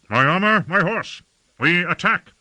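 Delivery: high-pitched, rousing command; battle summons. A narrator's reading. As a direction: call the household to arms. Lord calls for armor to attack.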